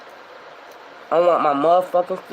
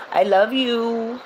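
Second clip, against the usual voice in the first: affectionate sign-off; 7 semitones higher, much slower than usual. Real, not acted. Sign-off to viewers.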